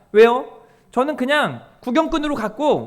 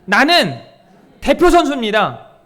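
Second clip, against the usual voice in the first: loud lead line for the crowd to repeat; call-and-response lead; 7 dB louder than usual. Shown as a demonstration. Pastor leading the congregation to repeat, loud call. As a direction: say it out for them to echo back. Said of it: "한번 따라해볼까요?".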